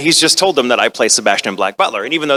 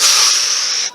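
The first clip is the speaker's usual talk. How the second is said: long hissing breath of dismay; collective sharp inhale. Retold or imitated. Entourage reacts to the slang, dismayed breath. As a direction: imitate a shocked entourage's breath. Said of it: "everyone else in his entourage were like".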